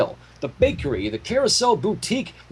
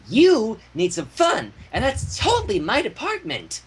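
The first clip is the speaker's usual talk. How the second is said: Pinky voice; 5 semitones higher than usual. Acted.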